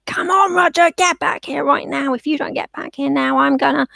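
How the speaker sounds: high-pitched, harried owner yelling for the dog; mock screaming. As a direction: imitate a frantic, scolding recall. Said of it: "if you are screaming at them".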